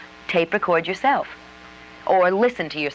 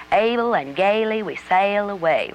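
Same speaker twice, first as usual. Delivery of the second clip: drawn-out southern accent, slow and higher; 4 semitones higher, slower than usual. Shown as a demonstration. Coach demonstrating a practice sentence for actors, southern accent. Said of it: "if it's a southern accent".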